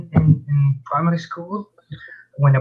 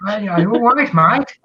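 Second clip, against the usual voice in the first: put-on a joking stab at an Aussie accent, pitched higher; mock Australian accent; 7 semitones higher, faster than usual. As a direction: tease her with a hammed-up Australian greeting. Mocking accent confusion.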